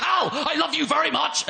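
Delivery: high, fierce-sounding Northern Irish declaration of love; hammed-up Northern Irish menace; scary Northern Irish accent. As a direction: imitate a loving wife who sounds frightening. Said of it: "being loving with that accent".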